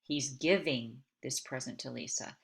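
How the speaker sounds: GIVING leaned on, brief break after it; stress on GIVING. Shown as a demonstration. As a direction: make GIVING the key word. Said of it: "that one kinda highlights, he's giving it".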